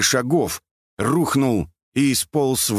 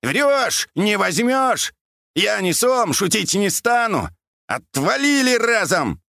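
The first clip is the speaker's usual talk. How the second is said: put-on high, defiant old man's yelling; high-pitched full-throated shouts; shouting at full throat; 12 semitones higher, slower than usual. A narrator's reading. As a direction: holler defiance across the water. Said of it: "выкрикивал во всю глотку".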